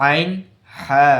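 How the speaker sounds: আইন squeezed from the middle of the throat, হা breathed loud; mid-throat আইন then a strong হা; Arabic ain from mid-throat. Shown as a demonstration. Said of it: "হালকের মধ্যখান হইতে".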